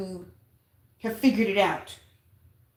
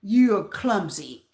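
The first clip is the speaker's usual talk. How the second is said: slowed, put-on voice for the cleaner; slow imitated cleaner; 3 semitones lower, much slower than usual. Cleaner telling the narrator off, slow imitated voice. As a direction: drag it out in his voice. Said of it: "And he said".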